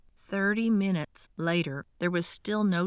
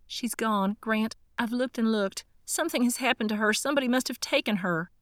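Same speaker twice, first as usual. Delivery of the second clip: higher and fast, words tumbling out in fear; frantic panic; 5 semitones higher, faster than usual. Acted. Darcy grabbing Grant's arm, her mother missing, frantic. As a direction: blurt it all out, terrified.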